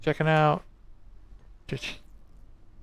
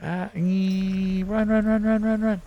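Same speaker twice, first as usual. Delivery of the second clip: high panicked chant of run; yelling while running away; 7 semitones higher, 5 dB louder than usual. Real, not acted. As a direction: yell run over and over, fleeing. Fleeing from mice.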